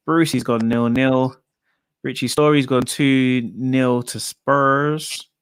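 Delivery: ordinary delivery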